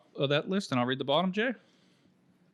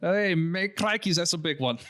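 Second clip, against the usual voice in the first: Australian accent; 3 semitones higher than usual. Shown as a demonstration. Showing where the Irish accent ends up, Australian accent. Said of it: "turns into an Australian accent".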